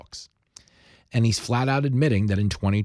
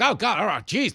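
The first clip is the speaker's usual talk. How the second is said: flustered giving in; 10 semitones higher than usual. Acted. Flustered tourist gives in to hustler.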